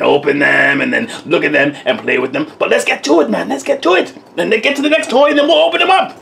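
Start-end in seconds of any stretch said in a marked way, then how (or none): 2.6-6.2 antsy. Real, not acted. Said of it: "You're getting really, really antsy".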